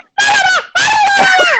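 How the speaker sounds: shrill mocking squeal imitating the subs; mock high scream. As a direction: squeal very high and loud in mockery. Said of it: "they started going".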